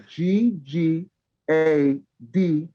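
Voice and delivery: slow, letter by letter; spelling it out. Shown as a demonstration. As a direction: spell out the handle slowly. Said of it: "Shout out your Twitter".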